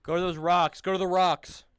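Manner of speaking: loud commanding call across the field; shouted order. Real, not acted. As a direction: yell it to the squad. Directing squad across terrain.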